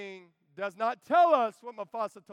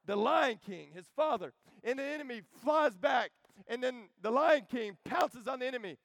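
normal talking voice

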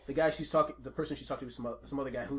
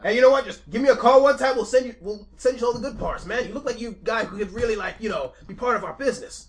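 a lightly voiced mafia boss bragging, louder; boastful mafia boss; 9 semitones higher, 11 dB louder than usual. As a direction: brag about the heist like a proud gangster. Mafia boss recruiting the player.

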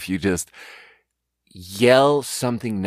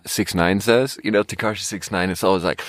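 ordinary delivery

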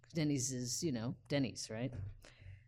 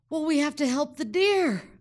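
much higher and louder, pleading hard; urgent plea; 11 semitones higher, 12 dB louder than usual. Retold or imitated. Injured rider urges her friend to save the deer, loud and pleading. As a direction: insist on helping the deer, loud and high.

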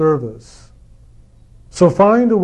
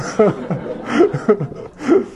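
laugh; 4 semitones higher than usual. Real, not acted. Laughing at own joke.

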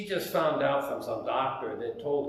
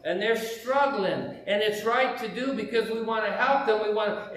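higher, louder, impassioned explanation; on a roll; 5 semitones higher, 4 dB louder than usual. Retold or imitated. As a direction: build momentum like you're preaching your cause. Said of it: "and then I'm on a roll".

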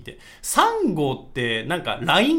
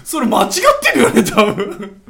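laughing while talking; 7 semitones higher, 13 dB louder than usual. Real, not acted. Laughing at the wrong lyric.

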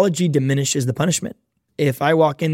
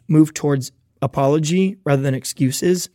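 ordinary talk

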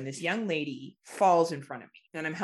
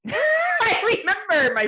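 high, slow start through laughter; laughing; 7 dB louder than usual. Real, not acted. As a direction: laugh your way into the memory. Laughing at memory of night out.

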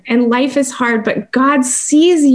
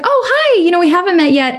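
much higher voice, mimicking a friendly stranger; friendly stranger's greeting; 9 semitones higher than usual. Lady greeting a new person at church, high and friendly. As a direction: imitate a high-pitched, chirpy hello to a newcomer.

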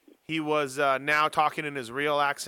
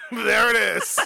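laughing it out, slow and high; 7 semitones higher, 3 dB louder, slower than usual. Real, not acted. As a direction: laugh through the words in delight. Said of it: "I've been waiting all year for that".

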